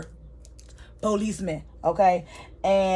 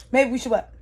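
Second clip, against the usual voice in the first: higher, insistent repeat of the question; challenging interruption; 5 semitones higher, 4 dB louder, faster than usual. Retold or imitated. Woman cutting him off mid-sentence, insistent. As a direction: push the question harder, demanding an answer. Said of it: "she cut him off".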